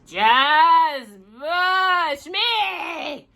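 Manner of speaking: very slow, high, drawn-out plea; pleading shout. Acted. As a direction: stretch the words in desperation. Desperate repeat.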